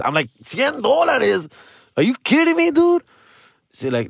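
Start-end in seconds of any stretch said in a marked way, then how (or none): 0.4-3.0 high, drawn-out mock disbelief; incredulous. Son reacting to mom's hundred-dollar pills, incredulous. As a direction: exclaim it way up high, balking at the price.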